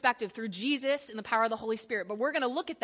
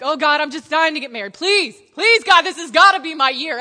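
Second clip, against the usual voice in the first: hammed-up pleading prayer; 2 semitones higher, 12 dB louder than usual. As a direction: beg God loudly for a spouse, hamming it up. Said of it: "I'm like".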